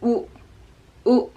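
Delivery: U said short with rounded lips; clipped short rounded U; short U sound. Shown as a demonstration. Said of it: "The next sound is U".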